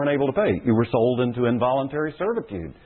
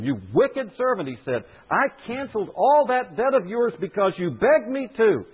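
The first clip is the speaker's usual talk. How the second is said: higher, indignant scolding; stern master's rebuke; 7 semitones higher than usual. Acted. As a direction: scold the servant like a wronged lord. Master rebukes wicked servant.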